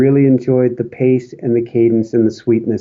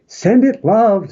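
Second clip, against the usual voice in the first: catchphrase blessing; 6 semitones higher, slower than usual. Shown as a demonstration. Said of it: "I wouldn't be me without saying".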